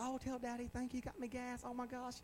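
high, soft, gushing imitation; imitating a grateful daughter. Preacher mimics her thanks for the gas, high gushing voice. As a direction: pitch it up in sweet, surprised gratitude. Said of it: "she saw it right off. Said".

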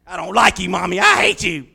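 put-on loud, shrill tantrum from a kid; mock child screaming at mommy; upset child. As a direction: shriek I hate you like a bratty kid. Child whose toy is taken.

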